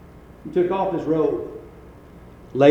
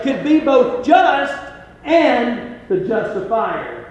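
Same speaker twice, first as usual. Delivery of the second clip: preaching; 6 semitones higher, 4 dB louder than usual. Acted. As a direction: build the verse up for the church. Preaching at full force.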